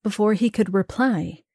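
plain reading voice

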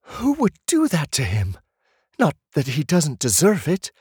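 sister voice. A narrator's reading. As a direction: play the sister, startled and bitter.

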